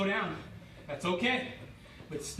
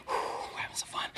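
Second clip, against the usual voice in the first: breathy whisper; 4 dB quieter, faster than usual. Real, not acted. Breathless aside after round.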